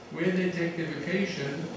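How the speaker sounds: ordinary talk, nothing marked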